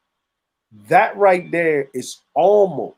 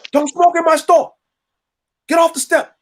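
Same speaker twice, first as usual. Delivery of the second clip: much higher, loud shout at the customer; mock shopkeeper yell; 13 semitones higher, 4 dB louder than usual. Shopkeeper yelling at the addict to leave the step, high and loud. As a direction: bark it out like an angry shopkeeper. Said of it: "you'll hear the Korean person yelling".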